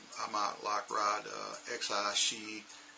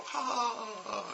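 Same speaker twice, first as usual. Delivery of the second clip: 1 class laughing; 13 semitones higher than usual. Retold or imitated. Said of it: "everybody go".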